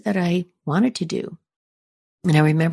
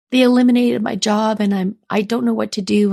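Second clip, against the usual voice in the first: high, faster, upset pleading voice on the phone; retold crying; 6 semitones higher, 3 dB louder, faster than usual. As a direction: replay the tearful call, voice lifting high. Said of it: "and I'm crying".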